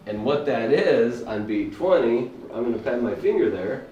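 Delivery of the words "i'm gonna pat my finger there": normal talking voice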